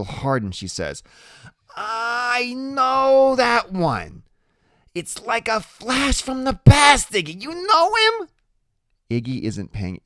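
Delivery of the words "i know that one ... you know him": very high, loud, stretched-out woman's voice; squinty biker's woman pressing her point; insistent Loretta. A narrator's reading. Loretta looking hard at the kid, high and insistent.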